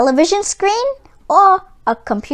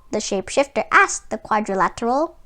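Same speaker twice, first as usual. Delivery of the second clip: usual speaking voice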